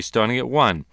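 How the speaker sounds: ordinary delivery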